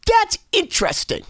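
high-pitched feigned fascination; feigned interest. Acted. Telling a newcomer how to get around, feigned interest.

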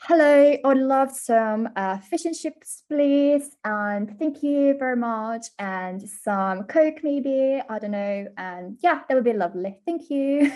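ordering in a playful British accent. Shown as a demonstration. Guest ordering fish and chips for host, playful British accent. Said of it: "order fish and chips with the authentic accent".

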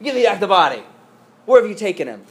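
high, urgent demand; frantic accusation. Acted. Mary to the supposed gardener, urgent and accusing. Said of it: "She thought it was the gardener".

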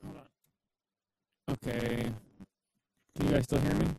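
crackling, robotic broken-up mic; staticky. Real, not acted. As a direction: voice buzzing through a bad line. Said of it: "Yeah, still staticky".

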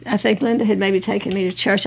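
ordinary delivery

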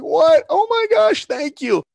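shrieked high and loud, near falsetto; ecstatic disbelief. Retold or imitated. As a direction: burst out like you just got the dream job. Dream job call, overjoyed disbelief.

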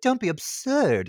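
put-on high, haughty slipping-accent British delivery; Kate Winslet. Mocking the actress losing her accent, high haughty British voice. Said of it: "loses her accent".

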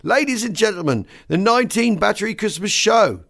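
high, ringing compere's call; showman's opening cry; announcer shout. Retold or imitated. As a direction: announce the show to the hall. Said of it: "Then I shout".

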